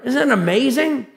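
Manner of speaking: normal talking voice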